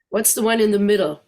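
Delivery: usual speaking voice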